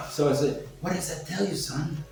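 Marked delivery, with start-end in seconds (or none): none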